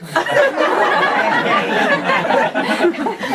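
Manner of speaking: very high laughter. Real, not acted. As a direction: burst out laughing. Laughing at own punchline.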